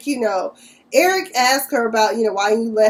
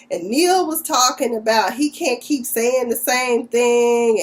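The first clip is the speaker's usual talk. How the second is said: ordinary talk, nothing marked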